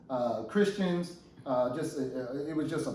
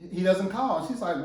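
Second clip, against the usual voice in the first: ordinary talk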